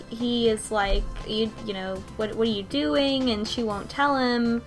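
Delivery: usual speaking voice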